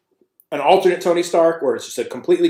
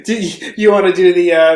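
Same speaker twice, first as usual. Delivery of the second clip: normal talking voice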